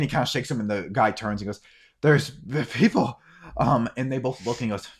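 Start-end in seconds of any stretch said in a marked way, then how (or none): 2.0-3.2 higher, louder, slow stammered alert; alarmed boy. Acted. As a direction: warn the guard, raised voice. Boy alerts guard to figures.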